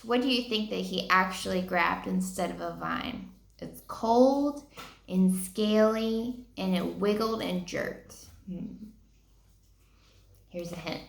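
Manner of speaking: put-on a hushed teacher's question to young listeners; teacher aside. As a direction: ask the children gently, leaning in.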